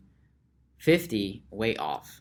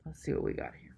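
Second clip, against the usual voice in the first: softer, breathy whisper read while typing; whispered muttering; 4 semitones higher, 8 dB quieter, much faster than usual. Real, not acted. Muttering the search while looking up lifespans. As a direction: murmur the search words to yourself.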